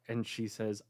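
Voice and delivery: normal talking voice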